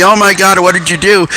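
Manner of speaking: mother screaming. Retold or imitated. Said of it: "while she's screaming at me".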